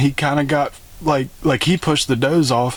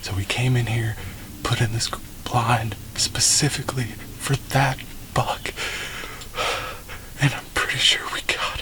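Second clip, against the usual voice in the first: breathless, awed hunter's whisper, softer; hushed whisper; 9 dB quieter than usual. Real, not acted. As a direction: whisper everything so the deer can't hear. Whispering in hunting blind.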